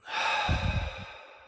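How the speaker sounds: audible sigh out through the mouth; long sighed exhale. Shown as a demonstration. Meditation teacher modelling a deep breath for listeners, long sigh. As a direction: let the breath pour out.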